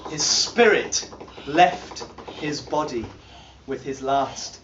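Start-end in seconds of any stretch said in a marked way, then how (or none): none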